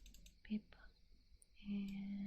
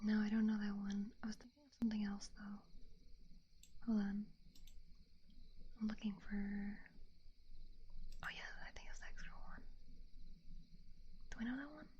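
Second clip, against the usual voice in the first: whisper; 10 dB louder than usual. Real, not acted. Whispering to stream viewers, puzzled whisper. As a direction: breathe the words, no voice.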